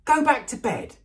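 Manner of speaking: loud, high angry order; high, forceful outburst of anger; furious. A narrator's reading. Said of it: "said Ron furiously".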